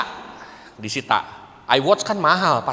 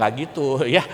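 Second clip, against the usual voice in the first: ordinary talk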